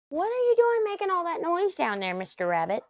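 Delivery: high sing-song call to a pet; calling to the rabbit. Real, not acted.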